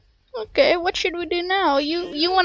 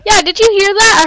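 excited; 6 semitones higher, 6 dB louder than usual. Real, not acted. Hears echoing yeets.

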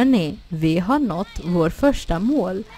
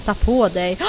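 ordinary talk, nothing marked

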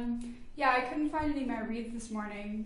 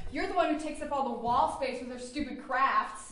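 ordinary talk, nothing marked

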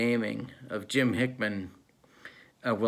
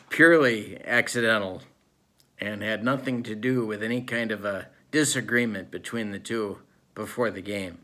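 normal talking voice

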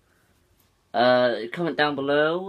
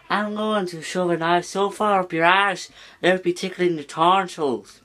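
angry, sweary Scottish tirade, pitched up; Scottish accent; 5 semitones higher, 3 dB louder than usual. Acted. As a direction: rant in a broad Scottish accent. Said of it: "Here's a Scottish accent".

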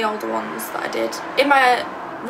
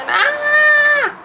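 high, loud fangirl squeal; a much higher, louder squeal of excitement; excited squeal; 6 dB louder than usual. Real, not acted. Fangirl reaction to One Direction.

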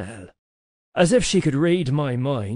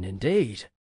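plain reading voice

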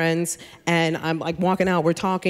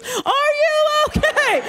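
very high, drawn-out doctor's yell; mock urgent yell; much slower than usual. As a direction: imitate a doctor yelling slowly at a patient. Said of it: "doctor comes running up".

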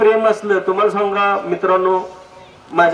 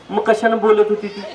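normal talking voice

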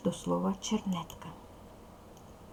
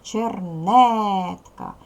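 "Чернетка" very slow, НЕТ stretched and much louder; stress on НЕТ; 8 dB louder, much slower than usual. Shown as a demonstration. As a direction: hit and hold the middle syllable: чер-НЕЕЕТ-ка. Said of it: "ставимо наголос". ("Чернетка" з протяжним, гучним наголосом на НЕТ)

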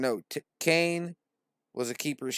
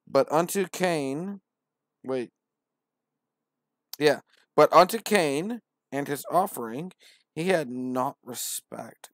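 slow, louder, higher preacher voice; grand scripture reading; 4 semitones higher, 7 dB louder than usual. Acted. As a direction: proclaim it like from a pulpit.

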